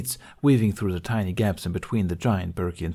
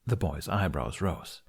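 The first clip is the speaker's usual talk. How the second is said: ordinary delivery, nothing marked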